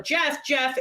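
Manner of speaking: ordinary talk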